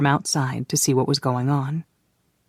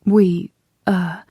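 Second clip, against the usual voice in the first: caught-out stammer; young woman stammering when teased about her lover; 4 semitones higher than usual. Acted. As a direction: fumble the words, embarrassed. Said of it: "Kay stammered".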